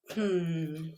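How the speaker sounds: whispered hmm, pondering; a soft musing hum; thoughtful hmm. Real, not acted. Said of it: "teacher musing aloud".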